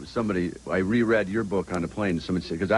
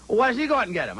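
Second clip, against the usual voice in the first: higher, louder mock wife voice; 13 semitones higher, 4 dB louder, faster than usual. Husband retelling his wife's complaint in the limo, mock high voice. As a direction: nag like an exasperated wife, hammed-up. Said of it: "she goes".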